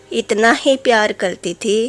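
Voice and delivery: usual speaking voice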